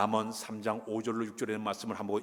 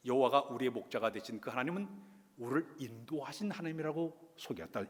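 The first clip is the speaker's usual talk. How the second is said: normal talking voice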